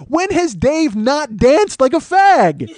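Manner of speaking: loud, high, drawn-out angry demand; exasperated retold yelling; pissed-off challenge. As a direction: hammer out the question slowly at full volume. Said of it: "'Cause I was pissed".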